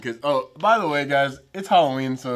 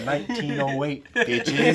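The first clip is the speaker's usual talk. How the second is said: smug, slow, laughing brag; cocky reveal; 2 semitones lower, slower than usual. Retold or imitated. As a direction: drop it like a boss. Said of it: "And I'll be like".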